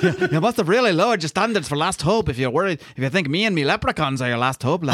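laughing stage Irish brogue; giggly hammed-up Irish brogue; mock Irish Banshee. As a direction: tease in a silly Irish accent. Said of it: "I just did an impression of".

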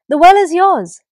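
bright, loud proclamation; Papa God handing the well to the animals, loud proclamation; generous declaration. Acted. Loud generous proclamation. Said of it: "said Papa God".

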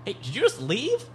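high, soft woman's voice; woman from the stall. Acted. Woman in the stall asking if he left, high and soft. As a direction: call out softly and high from a stall. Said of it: "hear the woman from the stall go".

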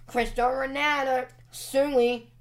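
high, loud "Sui" shout; Ronaldo celebration shout. Retold or imitated. Vlogger shouts Ronaldo's celebration at his photo, loud and high.

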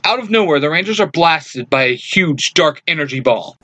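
ordinary delivery

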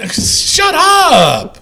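high, drawn-out "shut up"; exasperated high-pitched outburst; mock outburst. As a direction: cut them off with a stretched high cry. Mock outburst at co-host's catchphrase.

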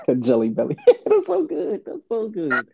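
cracking up through the words; can't say it without laughing, pitch climbing on the giggles. Real, not acted. Cracks up repeating the silly term.

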